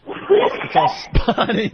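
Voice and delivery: laughing. Real, not acted. Laughing at friend's hair.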